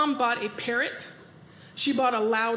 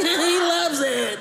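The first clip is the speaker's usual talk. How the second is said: bird lady; 3 semitones higher, 3 dB louder, much slower than usual. Retold or imitated. Crazy bird lady fawning over her parrot, slow and doting. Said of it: "she's like".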